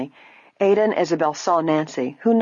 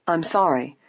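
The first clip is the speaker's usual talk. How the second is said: ordinary delivery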